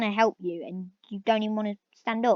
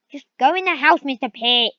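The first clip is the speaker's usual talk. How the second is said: angry command; 9 dB louder than usual. Real, not acted. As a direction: order the stubborn cow inside. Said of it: "I'm getting angry at this cow".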